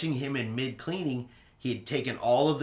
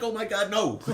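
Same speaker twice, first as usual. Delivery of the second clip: laughing refusal; 8 semitones higher than usual. Retold or imitated. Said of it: "And I'm like".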